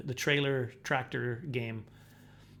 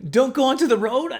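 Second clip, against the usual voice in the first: loud high recall; 10 semitones higher, 10 dB louder than usual. Retold or imitated. Recalling the warning, realization.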